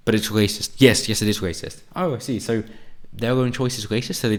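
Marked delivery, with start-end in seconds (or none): none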